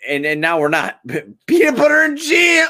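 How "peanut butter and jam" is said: high, sing-song outburst; silly exclamation. Acted. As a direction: blurt it out like a goofy jingle.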